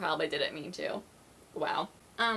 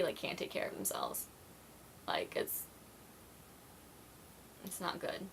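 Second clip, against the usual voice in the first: whisper; 2 semitones lower, 13 dB quieter than usual. Real, not acted. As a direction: whisper it under your breath. Muttered aside, unvoiced.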